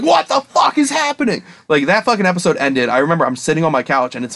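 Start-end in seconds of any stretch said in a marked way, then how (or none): 0.0-1.4 shocked confusion. Retold or imitated. Recalls shock at episode end.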